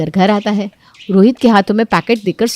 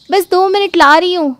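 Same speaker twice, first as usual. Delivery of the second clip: much higher, bright answer; hurried wife reply; 10 semitones higher, 4 dB louder than usual. Acted. Wife calling back to husband, hurried. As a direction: call back that it's coming. Daughter-in-law replies.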